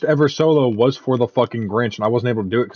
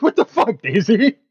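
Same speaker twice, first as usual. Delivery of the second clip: shocked; 11 semitones higher than usual. Retold or imitated. Retelling own shocked reaction.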